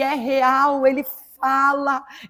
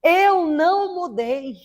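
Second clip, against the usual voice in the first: higher, louder, slow and commanding divine voice; God's voice shouting; 4 semitones higher, 5 dB louder, slower than usual. Retold or imitated. Retelling how God called her name, loud and commanding. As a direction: proclaim it like a voice from heaven thundering. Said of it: "aquela voz que gritou assim".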